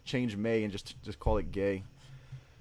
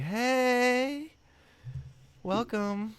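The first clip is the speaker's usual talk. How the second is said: high, camp sing-song greeting; 13 semitones higher, much slower than usual. Retold or imitated. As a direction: camp it up for the welcome. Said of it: "I'm coming like".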